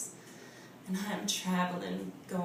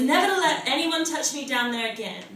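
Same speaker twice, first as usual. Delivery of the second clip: loud, higher, rapid scolding; raised-voice maternal warning; mother's yell; 5 semitones higher, 7 dB louder, faster than usual. Acted. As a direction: yell it as an angry mama's order. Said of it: "she yells at me".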